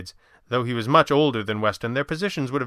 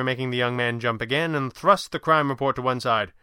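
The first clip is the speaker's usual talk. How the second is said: ordinary delivery, nothing marked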